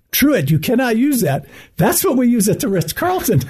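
high-pitched, indignant objection; mock protest. Hotelier objecting to his phrase being borrowed, high mock protest. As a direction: act out an exasperated colleague objecting.

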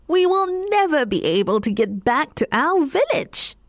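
cat fearing they're stranded; despairing cat. A narrator's reading. Cat telling the dog they're trapped, worried.

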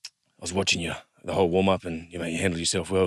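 a hint of a veteran quietly reassuring a rookie; Benji. Star halfback quietly telling a nervous debutant he did well, low and soft. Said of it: "Benji goes".

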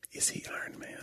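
soft breathy whisper; whispered question. Retold or imitated. Press conference quote.

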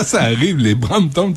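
ordinary talk

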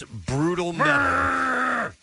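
high roaring shout on brutal metal. Acted. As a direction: scream it like a metal singer. Quoting angry metal desire.

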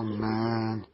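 ordinary talk

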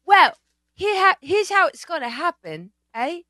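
halting, timid impression with pauses; timid talk. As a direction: imitate a man timidly laying out a deal. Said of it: "it was like a timid talk".

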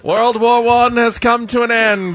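high, loud, slow newsboy shout; newsboy call. Pretend. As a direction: shout headlines like an old-time paperboy. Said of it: "Like a newsboy".

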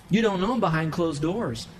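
ordinary talk, nothing marked

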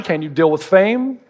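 usual speaking voice

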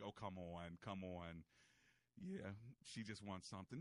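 low, soft, knowing mock grumble; a hint of a wife rolling her eyes; lightly voiced wife. Host imitating his wife, low and soft. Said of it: "And Amber's like".

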